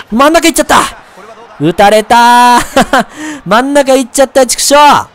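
high, wailing exclamation breaking into a laugh; dismayed outburst. Real, not acted. Pitcher reacting to his bad pitch, dismayed outburst. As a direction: yelp in exasperated dismay. Gave up a hit.

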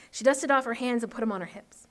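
usual speaking voice

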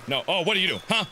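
normal talking voice

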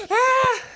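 high, loud short scream; frightened scream. Acted. Attacked by Orion, a frightened scream. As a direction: scream as you are grabbed.

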